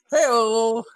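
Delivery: high, drawn-out punchline call; comic hey-o. Pretend. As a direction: call it out like a rimshot. Rimshot catchphrase after the joke.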